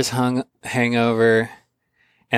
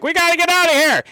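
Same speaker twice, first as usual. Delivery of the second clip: very high, fast, louder urgency; urgent alarm; 4 dB louder, faster than usual. Retold or imitated. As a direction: hurry everyone out. Donovan hurrying him out.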